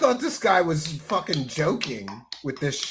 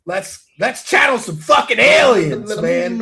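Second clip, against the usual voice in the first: hype shout; 2 semitones higher, 8 dB louder than usual. Real, not acted. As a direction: whip up the chat before the weird part. Hyping the chat.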